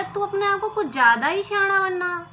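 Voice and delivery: sharp, loud, high telling-off; a thief snapping at the fool; angry scolding. Acted. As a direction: scold him for acting smart. Thieves scold the son-in-law angrily.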